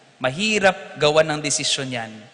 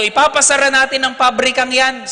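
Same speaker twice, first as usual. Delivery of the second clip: raised, higher voice of a determined priest; priest's firm declaration; 9 semitones higher, 8 dB louder than usual. Retold or imitated. Priest to the congregation, loud and firm. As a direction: announce the closure like a vow. Priest vows to close factory.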